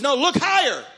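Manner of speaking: high, insistent re-enacted command; emphatic correction. God redirecting him above the woodpile, emphatic. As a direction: pitch it up and insist.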